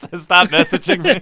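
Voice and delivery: breaking into laughter while talking; laughing. Real, not acted. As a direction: crack up mid-quote. Cracks up while quoting a text.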